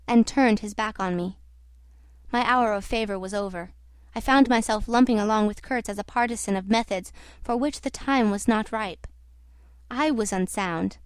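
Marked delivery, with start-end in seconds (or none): none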